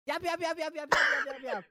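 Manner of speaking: high yapping dog sounds. Retold or imitated. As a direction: yap like a tiny dog. Imitating yappy dog.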